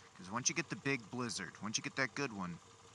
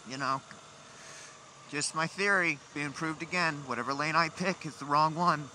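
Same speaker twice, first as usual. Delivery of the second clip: louder, higher exasperated rant; fed-up complaining, raised voice; frustrated rant; 6 semitones higher, 8 dB louder than usual. Real, not acted. Ranting about lane choice.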